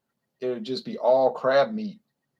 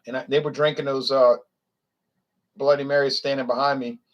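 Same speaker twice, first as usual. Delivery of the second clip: normal talking voice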